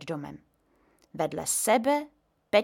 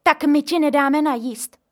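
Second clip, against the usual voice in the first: higher, louder scolding; mother scolding grandpa at home; angry reproach; 6 semitones higher, 8 dB louder than usual. A narrator's reading. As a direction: tear into grandpa. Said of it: "pustila se doma maminka do dědy".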